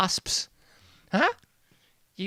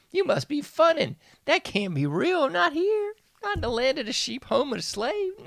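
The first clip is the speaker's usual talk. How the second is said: much higher, sarcastic put-on voice; pretending to be scandalized, pitched way up; mock shocked falsetto; 12 semitones higher than usual. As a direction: fake outrage in a squeaky high voice. Mock disbelief at racial backlash.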